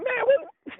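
very high exasperated "Man, what?"; high-pitched mock exasperation; frustrated self. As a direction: squeak it out in disbelief. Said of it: "I'm like".

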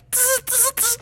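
short high bursts of buzz; bee buzzing. A narrator's reading. Bees swarming the players, buzzing. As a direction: punctuate speech with bee buzzes. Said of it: "the bees were like".

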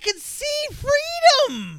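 very high, slow strained voice; mock strained victim. Mocking the crawling victim, high slow strain. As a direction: reach for freedom in a squeak.

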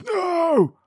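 very high, loud, drawn-out yell; hammed-up shout. Wife shouts back at her husband about pills, hammed-up high shout. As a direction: scream a long, overacted refusal. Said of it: "And she shouts".

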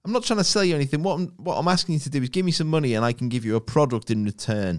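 quick, high sales patter; fake-matey salesperson voice; over-friendly cold caller. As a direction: rush through a mock telesales pitch. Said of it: "over friendly bollocks from someone".

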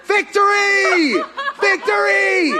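high, laughing shout of "Victory"; drawn-out jubilant laughing shout; mock victory cheer. Celebrates Kim's first baby step.

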